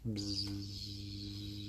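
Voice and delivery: soft hummed buzz; fly buzzing. Acted. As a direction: buzz like flies. Flies buzzing.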